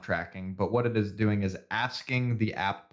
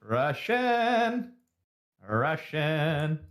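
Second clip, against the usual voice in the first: raised pitch, stretched-out playful word; playful repetition; 5 semitones higher, much slower than usual. Pretend. Scrolling through spam for a co-host, playful high repetition. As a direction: draw it out higher, playing with the word.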